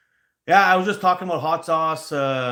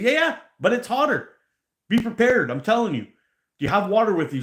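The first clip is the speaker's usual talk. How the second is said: urgent warning; 8 semitones higher than usual. Retold or imitated. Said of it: "I said".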